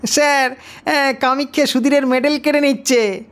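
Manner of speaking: high, eager schoolboy voice telling on a classmate; voiced schoolboy. A narrator's reading. Schoolboy telling the teacher a classmate is grabbing a medal, high and eager. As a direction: pipe up like a tattling kid.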